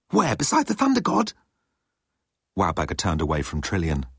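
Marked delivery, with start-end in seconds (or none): 0.1-1.3 high eager fangirl; breathless fan asking; teenage fangirl voice. A narrator's reading. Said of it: "sounding like a teenage fangirl".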